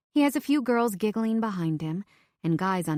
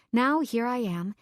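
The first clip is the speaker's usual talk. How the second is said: ordinary delivery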